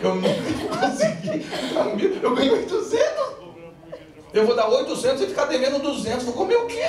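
high, laughing complaint to God; hammed-up plea to the Lord; mock pleading prayer. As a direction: play the broke believer begging God. Voicing a poor churchgoer praying.

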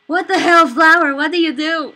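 loud, stunned outcry; shocked yell. Real, not acted. Player yelling at the flower that tricked her, shocked. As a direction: shout at the betraying flower.

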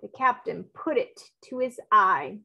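ordinary delivery, nothing marked

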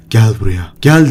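ordinary delivery

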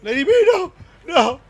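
sudden loud high cry of dismay; anguished shout. Real, not acted. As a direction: yell no as your teammate dies. Character dying in game.

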